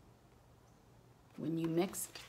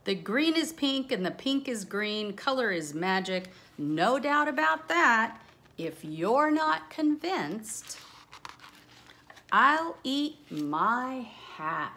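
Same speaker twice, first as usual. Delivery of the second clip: reading rhyme aloud; 6 semitones higher, 7 dB louder than usual. Acted. Reads rhyming picture book aloud.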